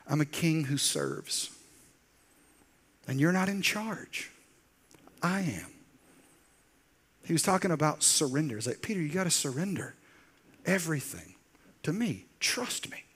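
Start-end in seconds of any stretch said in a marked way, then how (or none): 0.1-5.7 gentle firmness. Acted. Jesus gently correcting Peter. 8.8-13.0 softer, hushed plea ending in a whisper; soft coaxing. Acted. Jesus quietly urging Peter to surrender, softly to a whisper.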